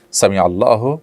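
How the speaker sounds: ordinary talk, nothing marked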